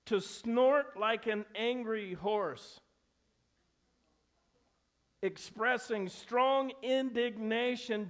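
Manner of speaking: slow emphatic definition. Shown as a demonstration. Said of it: "here's the Greek".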